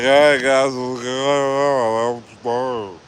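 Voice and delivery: put-on very slow, drawn-out drunken slur; groggy drunk-like waking. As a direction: act out waking up after twelve beers. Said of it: "like I drank, like, twelve beers".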